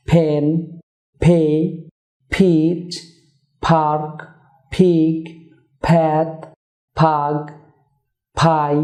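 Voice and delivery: ফ্যান ফে ফিচ each begun with a puffed p; aspirated p words. Shown as a demonstration. Teacher drilling p-initial words for viewers. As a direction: breathe out on every opening p. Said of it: "পি দিয়ে word শুরু".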